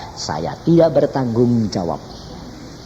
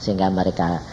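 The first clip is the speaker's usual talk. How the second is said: prayer; 4 semitones lower than usual. Acted. Preacher leading the congregation in closing prayer. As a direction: pray over the congregation.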